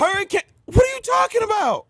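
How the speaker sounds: very high, incredulous cry; outraged disbelief. Real, not acted. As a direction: voice leaping up in protest. Outraged at film being called bad.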